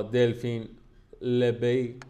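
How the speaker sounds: halting attempt at «دلفین لبی»; careful pronunciation. Real, not acted.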